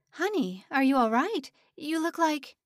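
high, loud and worried; sudden alarm for a dizzy girl; alarmed concern. A narrator's reading. Mrs Stern alarmed by Hannah.

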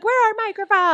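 squeaky mock question; mock high voice. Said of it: "You're like".

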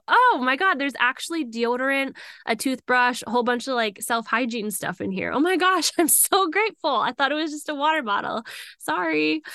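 high, excited gushing with laughs. Retold or imitated. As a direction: gush in grateful disbelief. Retold finding gifts in bag.

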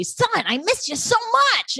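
high, gushing mock dad voice; a manic captain gushing over his son. Cohost retelling the captain's greeting, high manic mock gush. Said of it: "the captain's manic energy".

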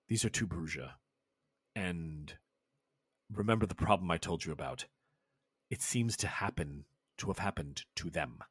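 put-on low, hushed, deliberate old servant; low quiet servant. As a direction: murmur it low like a loyal retainer. GM voices Grimgorth at length.

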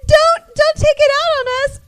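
put-on very high, pleading kid voice; pleading girl voice. Mean girls begging the princess, very high pleading. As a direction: beg in a high squeaky voice. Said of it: "And then she's like".

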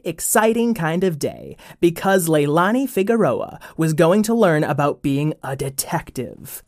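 plain reading voice

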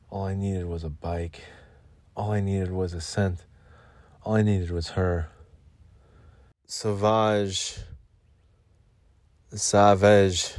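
soft, hushed moody ad voice; perfume ad monologue. Pretend. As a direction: breathe it like a cologne ad.

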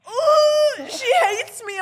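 high, loud, laughing half-cry; laughing mock panic. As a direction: shriek it through laughter, mock horrified. Retelling panic meeting his mum.

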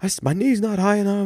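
put-on high, dejected kid voice; lightly voiced young pitcher admitting his mistake; imitating the boy. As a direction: sound like a discouraged little pitcher. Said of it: "He goes".

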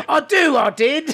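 high-pitched British parody; hammed-up British accent to annoy; mock British accent. Shown as a demonstration. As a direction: put on a silly, squeaky British voice. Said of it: "I do this British accent".

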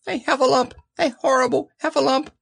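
high-pitched panicked squeal; terrified little squeaky cry; Piglet crying out. A narrator's reading. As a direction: shriek the alarm in a tiny creature's voice. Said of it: "cried Piglet".

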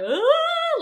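a sharp, high gasp of horror; high startled "ah" of horror; horrified gasp. Retold or imitated. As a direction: yelp like you're watching something awful. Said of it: "like I'm watching in horror".

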